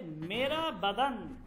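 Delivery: each word of মেরা বাদান drawn out slowly; slow teaching read. Shown as a demonstration. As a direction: read the Urdu phrase slowly for students. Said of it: "বলতেছে". (ধীরে ধীরে উচ্চারণ)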